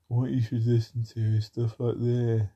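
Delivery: hint of a preachy outsider; a touch of lecturing mockery; mock know-it-all. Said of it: "And then they say".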